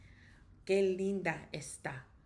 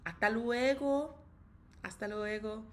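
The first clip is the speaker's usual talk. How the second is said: cheery goodbye; 4 semitones higher, 3 dB louder than usual. Real, not acted.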